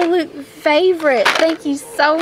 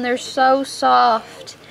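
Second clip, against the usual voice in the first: normal talking voice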